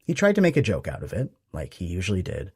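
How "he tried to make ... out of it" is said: plain reading voice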